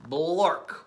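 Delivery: blurted nonsense word; silly nonsense word. Pretend. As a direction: bark out a silly made-up word. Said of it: "even if the words say".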